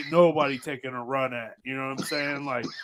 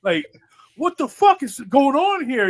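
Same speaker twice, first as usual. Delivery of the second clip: retold shocked yell; 11 semitones higher than usual. Yelling at his friends about the noise in the walls. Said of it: "And I'm like".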